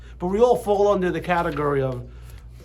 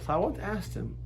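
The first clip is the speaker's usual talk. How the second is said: usual speaking voice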